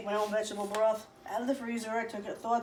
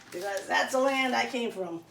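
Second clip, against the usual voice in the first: ordinary talk, nothing marked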